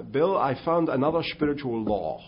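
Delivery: lightly put-on Dutch pastor accent. Retired pastor telling Bill Bright, light Dutch accent. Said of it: "in his great Dutch accent".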